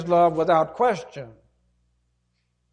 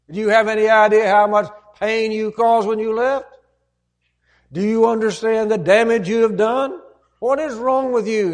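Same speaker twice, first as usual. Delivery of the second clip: sarcasm building to a loud, higher rebuke; scolding father; 6 semitones higher, 6 dB louder than usual. Retold or imitated. An angry father rebukes the prodigal son, building scolding. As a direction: berate him harder line by line. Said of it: "what some fathers might have said".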